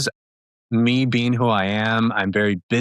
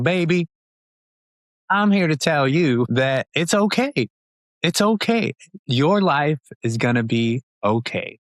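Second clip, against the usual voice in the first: higher-pitched, soothing Southern mom voice; Tabitha Brown's Southern accent; 5 semitones higher than usual. Retold or imitated. America's mom comforting followers on social media, reassuring Southern accent. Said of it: "it's like Southern accent".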